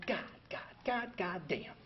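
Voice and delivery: breathy, near-silent cursing under the breath; muttered curse. Retold or imitated. Newcomer glaring at steps on the wall, whispered curses. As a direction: grumble it so nobody hears.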